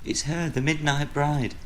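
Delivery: put-on high, alarmed child's voice; frightened little girl. A narrator's reading. Girl woken from nightmare telling father, frightened. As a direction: voice a scared child without screaming. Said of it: "screaming for her father".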